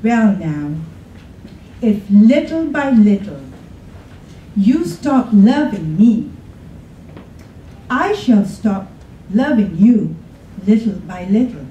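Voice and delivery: ordinary talk, nothing marked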